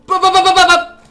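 high loud panicked syllables; frantic yelling. Real, not acted. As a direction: yell it out in panic. Frantic during jump.

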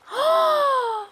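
sharp shocked high gasp. Real, not acted. Shocked gasp at game event. (şok içinde yüksek nefes çekiş)

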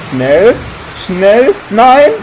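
loud, rising urgent cries; urgent panic. Real, not acted. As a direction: hurry them in rising panic. (dringend, panisch)

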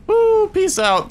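long, very high mock whoop; playful whoop. Player pretending to leap away, whooping playfully.

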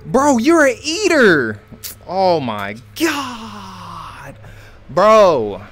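very high, loud disbelieving yell; shocked yell. Real, not acted. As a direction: scream it in disbelief. Shocked at surviving enemy.

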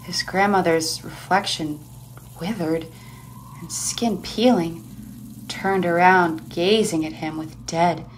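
hushed narration. A narrator's reading.

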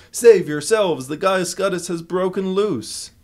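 loud, high warning cry to a crowd; panicked yell. Acted. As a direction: shout a frantic alarm to the audience. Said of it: "yelled to the audience".

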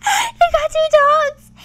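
gasp, then squeal it slowly; gasping delight. Real, not acted. Player squeals with delight at her game dogs.

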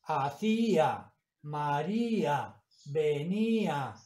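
"Hacía, María, venía" slowly, each í stretched apart from the a; drawn-out hiatus. Shown as a demonstration. Teacher giving examples of accented hiatus, drawn-out hiatus. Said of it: "los hiatos de vocal fuerte y débil llevan tilde".

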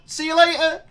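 high, loud gloating farewell; mocking goodbye. Real, not acted. Taunting Ollie after killing him, high gloating goodbye. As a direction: wave him off with glee.

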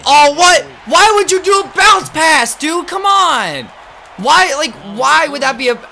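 loud, high frustrated shout; frustrated yelling. Real, not acted. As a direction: yell at the teammate. Upset at a bad pass.

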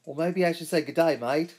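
a hammed-up, half-hearted Australian twang; bad Australian accent. Greeting a viewer in a mock Australian accent. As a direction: toss off a deliberately terrible Australian greeting. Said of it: "Sorry, that was terrible".

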